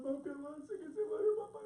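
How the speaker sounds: rising, high-pitched acted urging; desperate plea. Daughter hurrying the rabbi out, desperate plea. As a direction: urge him on in mock desperation. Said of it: "la joven desesperada".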